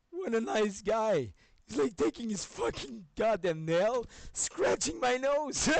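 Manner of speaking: high, loud, slow words in laughter; laughing through it. Real, not acted. Describing the cat clawing his nose, laughing hard.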